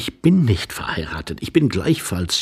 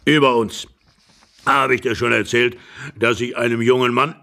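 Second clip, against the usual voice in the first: put-on higher old man's voice, broken by coughs; rambling old husband; 5 semitones higher than usual. Old husband tells of a young man with moustache, coughing. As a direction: ramble on through coughing fits.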